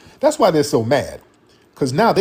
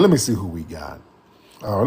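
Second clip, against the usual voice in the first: usual speaking voice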